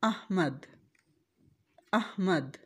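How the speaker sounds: अहमद with zabar on alif, soft and careful; अहमद repeated as ahmad; correct Ahmad. Shown as a demonstration. Teacher repeating the correct अहमद softly. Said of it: "हमने अलिफ के ऊपर ज़बर लगानी है".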